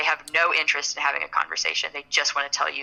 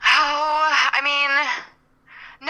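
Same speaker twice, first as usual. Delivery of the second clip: ordinary talk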